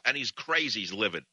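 usual speaking voice

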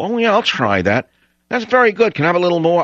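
high, mocking voice of a freeloading taster; mocking freeloading liberal. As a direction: pitch it way up to ridicule the freeloaders.

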